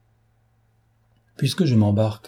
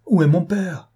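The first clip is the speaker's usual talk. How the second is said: higher, louder, trembling with anguish; anguished cry; 7 semitones higher, 3 dB louder than usual. A narrator's reading. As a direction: cry out for her father in desperate hope. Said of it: "palpitante de douleur, d'angoisse et de tendresse".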